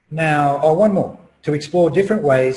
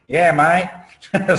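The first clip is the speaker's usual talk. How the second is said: rough attempt at broad Australian, pitched up; broad Australian accent; 4 semitones higher, 4 dB louder than usual. Speaker tries recalling family friend's voice, mock Australian accent. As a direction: put on a mock Aussie accent, higher. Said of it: "with an Australian accent, a very broad Australian accent".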